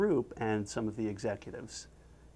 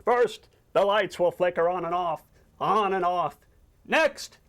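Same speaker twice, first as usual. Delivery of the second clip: raised, dramatic spooky announcement; ghost-story teller; 6 semitones higher, 4 dB louder than usual. Acted. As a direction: announce each omen of a ghost tale, pitched high. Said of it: "Squidward tells a ghost".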